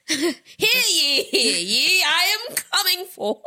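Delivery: high, slow proclamation voice; town crier. Pretend. Theatrical sex party announcement.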